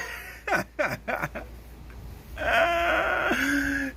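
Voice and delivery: high, long burst of laughter. Real, not acted. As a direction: laugh hard at the memory. Laughing after retold challenge.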